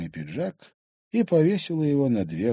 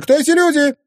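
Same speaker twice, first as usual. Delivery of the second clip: very high, loud question; indignant demand; 11 dB louder than usual. Acted. Wife demanding who the intruders are, indignant. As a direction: demand an answer in outrage. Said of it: "возмутилась Анфиса".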